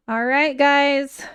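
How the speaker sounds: normal talking voice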